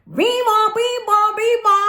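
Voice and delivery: high, loud two-note siren wail; imitated siren. Telling viewers how the alarm sounded, imitated siren.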